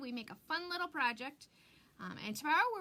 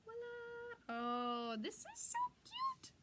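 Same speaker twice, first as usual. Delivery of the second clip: high delighted squeal; 11 semitones higher, much slower than usual. Real, not acted. Crafter unveiling her finished sign, squealing with delight. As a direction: voice jumps way up, slow and gushing. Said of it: "how my voice gets really high".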